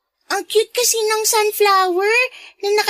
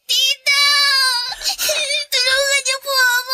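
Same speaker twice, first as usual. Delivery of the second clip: higher, sobbing pleas, slow at first; crying in pain; 3 semitones higher than usual. Acted. Abigail begs Aline to stop hitting her, sobbing. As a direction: beg through tears to make it stop. Girl being hit, begs for help.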